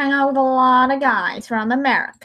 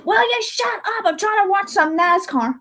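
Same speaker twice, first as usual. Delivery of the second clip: annoyed friend; 6 semitones higher than usual. Retold or imitated. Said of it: "you're like".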